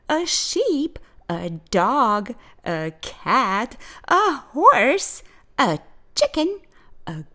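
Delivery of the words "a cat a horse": ordinary delivery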